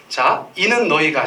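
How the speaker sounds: slow, deliberate reading pace; read slowly. Shown as a demonstration. Pastor leading the congregation in slow reading. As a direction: give each word its time. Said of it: "요거 천천히 읽겠어요".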